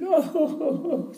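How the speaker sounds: high, hammed-up sobbing moan; mock crying. Cleaning lady cries in his office, high mock sobbing. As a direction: imitate her wailing on a high note. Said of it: "She starts crying".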